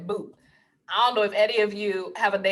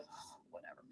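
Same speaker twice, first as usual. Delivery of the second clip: unvoiced dismissive whisper; breathy whatever under the breath; 30 dB quieter than usual. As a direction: whisper it, unimpressed. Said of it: "And I go".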